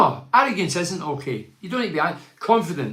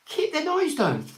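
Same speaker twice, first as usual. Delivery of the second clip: much higher, slow disbelieving echo of noise down; incredulous; 13 semitones higher than usual. Real, not acted. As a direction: repeat it like you can't believe anyone wrote it. Mocking the letter in disbelief.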